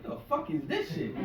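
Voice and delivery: high, disgusted voicing of the dog; dog refusing tap water, voiced high and disgusted; dog's look. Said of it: "look at me like".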